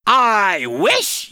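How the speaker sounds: loud high yell. Acted. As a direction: shout it out to the world. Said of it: "yelled the duck".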